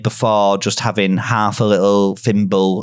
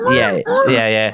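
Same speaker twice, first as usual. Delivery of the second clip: pig squeals. Pretend. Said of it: "Get some noises, some pigs going on".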